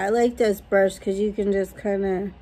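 ordinary talk

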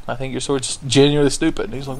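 normal talking voice

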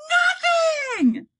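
very high, loud braying shout; donkey named Nothing brays. Acted. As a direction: bray it like a donkey. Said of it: "he brayed".

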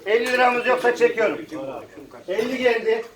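calling out bids at auction; rhythmic auction calling; auctioneer. Real, not acted.

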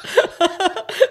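loud, high burst of laughter; laughing. Real, not acted. Co-host cracking up after plugging the podcast, laughing. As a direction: burst out laughing at your own joke. Laughs at own plug joke.

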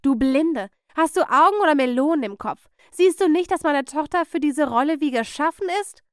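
loud, high, indignant berating; outraged stage mother, voice climbing; angry tirade. Acted. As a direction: rage at the director for overlooking your daughter. Aunt rages at director.

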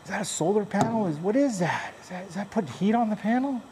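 high, baffled customer questions; puzzled customer. Retold or imitated. Customer quizzing the stepdad, high and baffled. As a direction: play the confused customer, pitch up. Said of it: "customers would, uh, go".

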